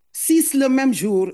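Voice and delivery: "Six" hit high and hard in disbelief; stress on six. Real, not acted. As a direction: punch "Six" with outrage. Outraged mother repeats six fines. (appuyer fort sur « Six »)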